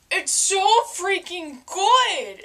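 slow, loud emphatic praise; drawn-out rave. Real, not acted. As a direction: stretch every word out. Raving over taste.

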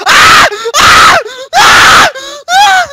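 piercing very high screams; terrified screaming. Real, not acted. Gamer cornered by enemies, terrified piercing screams. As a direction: scream for your life. Under attack in game.

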